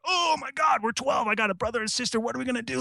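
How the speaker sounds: put-on high, panicky child's worry; a scared twelve-year-old fretting; panicked kid. Young me panicking over mom's money talk. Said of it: "And I'm like".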